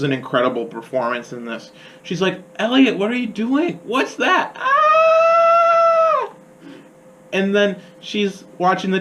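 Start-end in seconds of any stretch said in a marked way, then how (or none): 2.6-4.6 put-on high-pitched startled kid voice; imitating a startled little girl; alarmed little sister. 4.6-6.3 very high, sustained shriek; imitated little girl's scream; mock scream. Imitates sister's scream in film.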